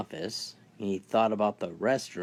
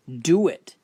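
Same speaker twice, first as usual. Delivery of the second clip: sharp order; 6 semitones higher, 7 dB louder than usual. Acted. Robber orders nurse.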